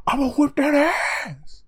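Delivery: high, loud, slow mock battle cry in a put-on voice; hammed-up charge as Leorio leaps in. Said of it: "He's just like".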